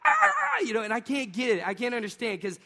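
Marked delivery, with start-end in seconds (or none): 0.0-0.6 a very high, loud wailing scream; imitated child scream. Dad imitating his son's pained wail, very high and loud. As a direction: shriek like the finger's caught. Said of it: "He's like".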